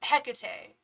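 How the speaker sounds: Hecate front-stressed as HEK-uh-tay; stress on first syllable. Shown as a demonstration. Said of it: "emphasis on the wrong syllable".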